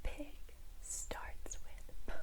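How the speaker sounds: barely audible whisper; whispered prompt. Shown as a demonstration. Teacher leaving room for the student's answer, whispering. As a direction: whisper the answer under your breath.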